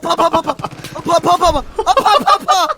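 loud panicked yelps; screaming while falling. Real, not acted. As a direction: yelp in high pain bursts. Burning in game, screaming.

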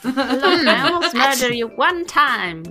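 ordinary talk, nothing marked

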